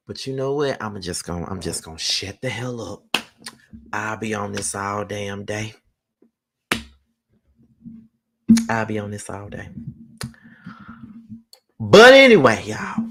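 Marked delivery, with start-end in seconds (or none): 0.1-9.7 much softer and lower, half to himself; trailing off quietly. Real, not acted. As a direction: let it sink into a low murmur. Drops voice, says he'll shut up.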